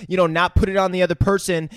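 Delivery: ordinary talk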